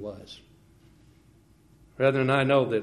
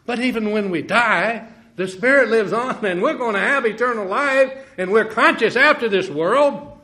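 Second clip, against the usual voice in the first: high, loud preaching cadence; rising revival sermon voice; fervent preaching; 10 semitones higher, 9 dB louder than usual. Acted. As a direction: lift it into full fervent preaching. Preaching at full force.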